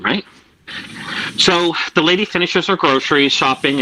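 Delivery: normal talking voice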